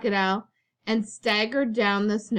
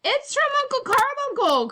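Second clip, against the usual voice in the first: much higher excited cry; 4 dB louder than usual. A narrator's reading. Cousin reading the gift tag, crying out excitedly. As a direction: burst out with delighted surprise. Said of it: "cried Cousin Elwood".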